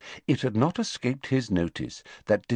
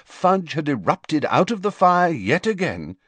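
ordinary delivery

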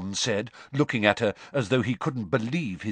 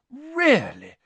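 loud, lofty and dismissive; a superior single word, voice lifted high; 13 semitones higher, 5 dB louder than usual. Acted. Hermione to the squabbling girls, loud and lofty. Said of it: "Hermione said loftily".

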